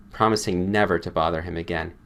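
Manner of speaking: ordinary delivery, nothing marked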